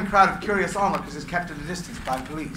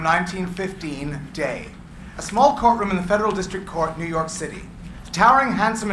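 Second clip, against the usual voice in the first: ordinary delivery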